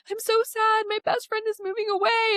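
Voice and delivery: a sad little kid, voiced way up high; upset child. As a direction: mimic a kid wailing about their friend. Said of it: "child comes to you and it's like".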